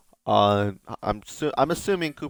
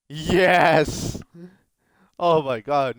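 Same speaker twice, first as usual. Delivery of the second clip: louder, higher, drawn-out yes; triumphant cheer; 6 semitones higher, 4 dB louder, much slower than usual. Real, not acted. Gamer gets the result he begged for, triumphant. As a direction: let out a real victory cry.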